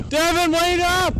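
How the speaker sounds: shouting to a friend riding far ahead; calling out. Real, not acted. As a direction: yell after a friend pulling away.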